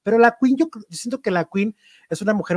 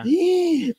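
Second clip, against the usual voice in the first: imitated terrified scream; 13 semitones higher than usual. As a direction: mimic a panicked squeal at normal volume. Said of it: "te empieza a gritar Laura Bozzo despavorida". (grito despavorido fingido)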